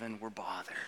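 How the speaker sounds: breathy whisper, no voice; whispered aside. Pretend. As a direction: hush it like a sulky confession. Mimicking bothered grumble.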